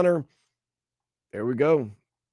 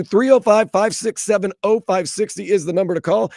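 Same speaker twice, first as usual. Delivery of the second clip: number called out high and loud; energetic announcer read; 6 semitones higher, 3 dB louder than usual. Acted. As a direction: sell the phone number with energy. Host gives call-in number.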